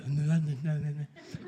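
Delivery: imitated garbled talk through a swollen tongue; wordless "nuh-nuh" babble, mimicking a tongue too big to talk; swollen-tongue mumble. Telling the audience about talking after a tongue piercing, mock tongue-tied mumbling. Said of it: "'cause my tongue was all swollen".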